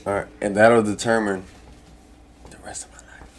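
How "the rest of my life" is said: whispered. Real, not acted. Dramatic stakes of open mic.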